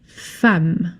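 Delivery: "femme" said fam, with an open a. Shown as a demonstration. Teacher showing the class "femme" said fam. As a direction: say "femme" as fam, never with an e sound. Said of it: "You say femme".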